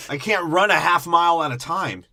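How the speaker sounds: whiny half-yell at the robot; mock panicked human. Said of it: "He's like".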